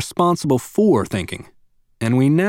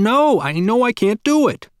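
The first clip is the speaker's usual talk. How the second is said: put-on screaming little girl; 10 semitones higher than usual. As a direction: wail it like a frustrated four-year-old. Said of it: "she screamed".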